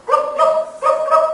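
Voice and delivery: chicken noise. Acted. Chicken's sound in story.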